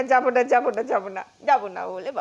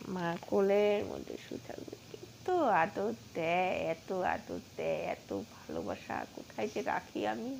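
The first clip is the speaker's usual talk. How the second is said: tender murmur; 6 semitones lower, 13 dB quieter than usual. Real, not acted. Owner cuddling the pet in her lap, tender coo. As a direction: murmur lovingly into the fur.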